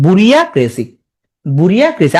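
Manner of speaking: "বুরিয়াক্রেসি" with a full "বুরিয়া" before "ক্রেসি"; memory-aid pronunciation. Shown as a demonstration. Teacher telling students to say it this way, spelling-style. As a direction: lean on "বুরিয়া", then add "ক্রেসি". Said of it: "তাহলে তুমি এমনি বলো".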